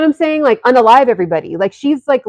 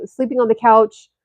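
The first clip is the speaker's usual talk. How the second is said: ordinary talk, nothing marked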